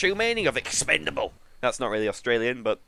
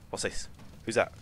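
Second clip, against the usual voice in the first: ordinary talk, nothing marked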